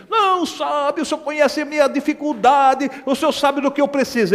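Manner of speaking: imitating a crying believer; mock whiny prayer. Whining believer again. (oração chorosa imitada)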